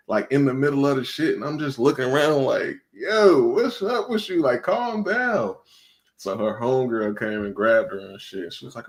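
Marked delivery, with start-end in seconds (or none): 2.9-5.5 high-pitched, stretched-out appeasing; imitating bewildered protest. Man retelling his reply to the yelling woman, bewildered. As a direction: replay a taken-aback plea, drawn out high.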